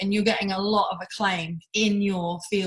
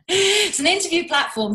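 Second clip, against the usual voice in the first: laughing; 10 semitones higher, 5 dB louder than usual. Real, not acted. Laughing after being given word.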